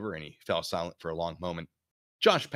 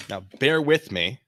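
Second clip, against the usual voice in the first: usual speaking voice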